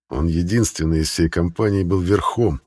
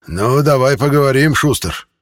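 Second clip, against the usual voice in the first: higher, louder hoarse shout; 7 semitones higher, 5 dB louder than usual. A narrator's reading. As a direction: call down in a husky rasp. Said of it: "сиплый голос". (сиплый голос)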